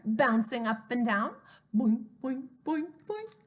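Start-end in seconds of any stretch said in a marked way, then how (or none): 1.7-3.4 bouncy rising boings; boing sound effect. Acted. Reader bounces the hare for children, playful boings. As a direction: make the hop sound playfully.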